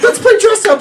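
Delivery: put-on high, loud giggly little kid; child voice. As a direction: squeal it like an excited four-year-old. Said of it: "like four-year-olds".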